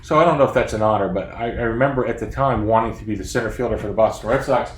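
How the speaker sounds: ordinary talk, nothing marked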